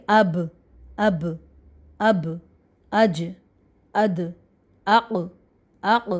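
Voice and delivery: "اب، اج، اد، اق" each cut off on a vowelless final consonant; teacher sounding out "اب، اج، اد، اق" as separate syllables ending on the bare consonant; silent-letter articulation demo. Shown as a demonstration. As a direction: say "اب، اج، اد، اق" one by one, releasing the last letter without a vowel. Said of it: "silent کر دیں گے".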